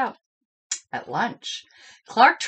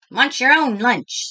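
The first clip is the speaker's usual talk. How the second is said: a touch of a grumpy friend, louder; lightly voiced friend; 5 semitones higher, 6 dB louder than usual. A narrator's reading. Joey telling Clark off at lunch, mildly cross. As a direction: friend snapping back mildly. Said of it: "said his best friend Joey Mackerel".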